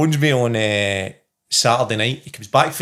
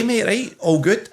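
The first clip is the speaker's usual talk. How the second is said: ordinary talk, nothing marked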